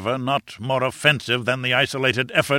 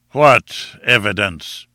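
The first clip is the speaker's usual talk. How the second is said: menacing calm; 8 dB louder, much slower than usual. A narrator's reading. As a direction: challenge him slowly with controlled menace. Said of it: "He spoke with a menacing calm".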